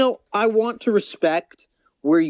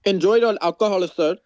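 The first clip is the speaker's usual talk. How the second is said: higher-pitched put-on Indian accent; mock Indian accent; 5 semitones higher than usual. Bantering as a teetotal parent ordering homework, mock Indian accent. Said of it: "Do an Indian accent right now".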